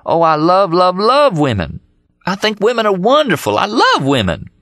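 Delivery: overflowing with joy, nearly singing it; ecstatic shout. Acted. Dean ecstatic in New Orleans.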